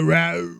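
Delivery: loud mock zombie growl; zombie roar. Zombie finding the hiders, mock roar. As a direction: imitate a zombie roaring. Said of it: "Zombie like".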